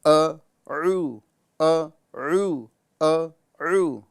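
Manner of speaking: very slow ا then throaty عو; slow drill of ا against عو; hamza then ain. Shown as a demonstration. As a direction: contrast ا and عو slowly and clearly. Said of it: "توجہ دیجیے۔".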